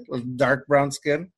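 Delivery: ordinary talk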